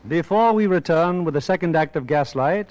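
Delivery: normal talking voice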